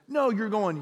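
normal talking voice

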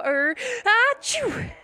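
drawn-out, high, loud storybook "achoo"; big acted sneeze. A narrator's reading. Reader acts out the king's sneeze, exaggerated sneeze. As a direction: wind up slowly, then burst into a mock sneeze. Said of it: "he felt a sneeze coming on".

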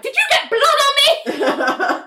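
a high pitched squeal of disgust; squeamish coworker. Retold or imitated. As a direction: play it as a fussy, grossed-out coworker. Elaine squeamish about blood.

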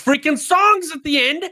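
sudden loud burst, slow; excited shout. Real, not acted. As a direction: shout it out, drawn out. Excited jab about songs.

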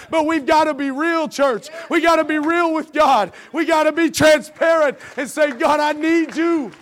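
loud, high, crying out to the church; shouting preacher. Acted. Pastor calls on the congregation to be real, loud shout. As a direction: hit the climax with full voice. Climax of sermon, calling out.

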